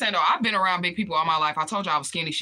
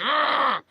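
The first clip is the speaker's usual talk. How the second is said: disgusted ugh; 9 semitones higher, 5 dB louder than usual. Retold or imitated. Her own disgusted reaction.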